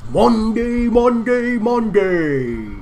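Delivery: loud, high, stretched-out announcer chant; event announcer impression. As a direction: imitate a hype announcer, drawing out each word. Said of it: "That one guy who goes".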